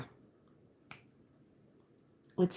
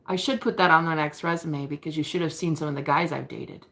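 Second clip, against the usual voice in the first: ordinary delivery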